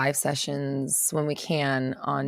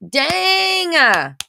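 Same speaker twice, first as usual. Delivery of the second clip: excited exclamation; 12 semitones higher, 8 dB louder than usual. Real, not acted. Reacting to viewer's money news.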